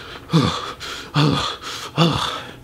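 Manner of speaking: acted out-of-breath heaving breaths; heavy, spaced huffs as if winded; winded panting. Storyteller who just ran over, winded.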